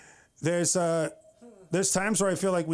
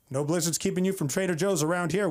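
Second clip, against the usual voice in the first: normal talking voice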